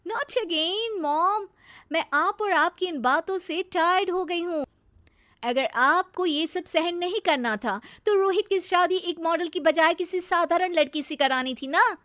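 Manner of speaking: high-pitched, exasperated complaint; fed-up bahu. Acted. Bahu tells mother-in-law she is tired of her nagging, exasperated. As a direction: whine back, thoroughly annoyed.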